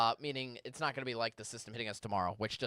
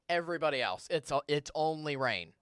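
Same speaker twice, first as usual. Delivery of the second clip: ordinary talk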